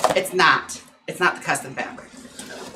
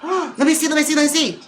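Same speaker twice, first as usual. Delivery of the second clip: excited; 10 semitones higher, 4 dB louder than usual. Real, not acted. Eagerly opening a long-awaited package.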